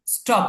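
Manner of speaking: স্টপ with ট, no puff of breath; unaspirated T. Shown as a demonstration. Teacher corrects the reading for students, স্টপ with ট sound. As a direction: say স্টপ with a plain retroflex T. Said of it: "ট এর মতো উচ্চারণ হবে".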